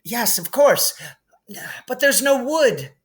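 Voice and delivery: put-on high, distressed girl; lightly acted distress; cried out. A narrator's reading. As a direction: wail it hand-wringing. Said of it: "Hermione cried".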